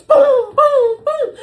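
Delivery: high, loud booms; three explosive punch sound effects; imitated punch noises. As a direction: hit each boom hard and high. Imitating haymakers landing.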